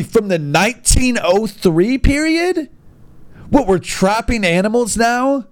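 ordinary talk

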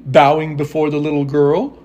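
usual speaking voice

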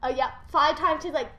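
ordinary talk

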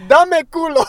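high, very loud goofy yell; joking shout. Pretend. Clowning in bad Spanish with a friend, high loud yell. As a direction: belt it out as a joke.

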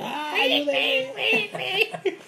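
very high-pitched mock cry for help; hammed-up imitation of a victim's scream. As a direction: squeal for help like someone in a panic, as a joke. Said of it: "Escuchar cómo grita".

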